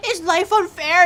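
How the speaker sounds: hammed-up teasing partner; mocking boyfriend. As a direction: sneer out a mock question. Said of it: "He's like".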